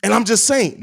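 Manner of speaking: lightly voiced pundit wrap-up; Pat the Designer impression. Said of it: "that's how Pat the Designer would say it".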